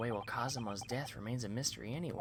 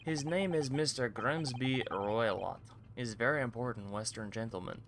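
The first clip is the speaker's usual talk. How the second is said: Russian sailor boasting. Acted. Guard brags about the VIP cabins, lightly Russian-accented. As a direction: show it off like a proud Russian guard.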